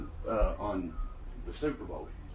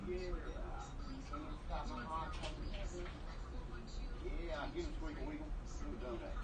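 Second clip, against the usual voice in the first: high pet talk; 10 semitones higher, 14 dB quieter than usual. Real, not acted. Cooing at pet animals.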